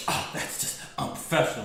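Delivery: breathy, voiceless huff; gasped, whispery indignation; mock appalled. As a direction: imitate a scandalized customer out of breath. Outraged customer, breathless retort.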